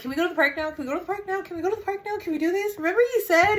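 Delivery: put-on high, whiny kid begging to go to the park; little kid. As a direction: nag like a child who was promised. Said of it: "literally like a little kid".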